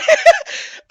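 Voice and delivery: a sudden high burst of laughter. Real, not acted. Streamer laughing at her own outburst, high laugh. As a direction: crack up at yourself.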